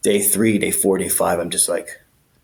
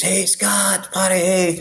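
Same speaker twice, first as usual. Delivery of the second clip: high, overly chummy cop voice, fast on the sales pitch; butter him up like an old buddy; fake-friendly cop; 6 semitones higher than usual. Cop sweet-talking an inmate at the jail, high chummy voice. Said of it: "It's like we're old buddies".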